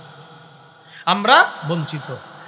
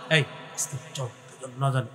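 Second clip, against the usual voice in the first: low, softer conspiratorial murmur; hushed; 6 semitones lower, 9 dB quieter, faster than usual. Shown as a demonstration. Preacher acts the brothers' secret plan, hushed. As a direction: say it as if nobody must hear. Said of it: "এটা হলো অনুবাদ।".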